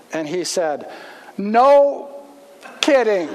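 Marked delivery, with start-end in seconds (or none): 1.4-3.3 loud, high burst of disbelief; loud astonished exclamation; stunned no kidding. Retold or imitated. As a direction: blurt it out, floored by the news. Guy stunned the teller's a pastor.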